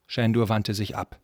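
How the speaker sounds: usual speaking voice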